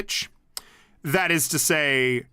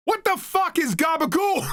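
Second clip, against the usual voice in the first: much higher mock outburst, "What the fuck is gabagool?"; confused heckler; 12 semitones higher than usual. Heckler in the crowd shouting his confusion, mock high. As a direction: play a baffled heckler standing up in the crowd. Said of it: "they're just like".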